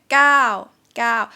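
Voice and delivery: gao held long, rising then falling. Shown as a demonstration. Teacher showing the long vowel, loud falling tone. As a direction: stretch gao out and let it drop. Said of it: "pretty long here".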